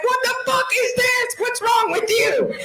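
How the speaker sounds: high shouted outrage at the dog; mock outraged shout. Comedian yelling at her farting dog, high outraged shout. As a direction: yell it like the stink just hit you. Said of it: "I'm like".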